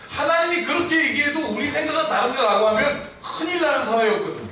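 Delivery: high, emphatic preaching; forceful preaching. Real, not acted. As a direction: drive the point home from the pulpit.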